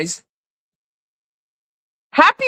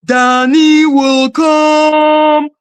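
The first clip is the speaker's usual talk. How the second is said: slow drawn-out mock; 3 semitones higher, 4 dB louder, much slower than usual. Drawn-out mocking repeat.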